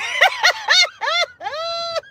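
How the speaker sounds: shrill-pitched cackle after the punchline; high cackling laugh. Real, not acted. Laughing at her own joke.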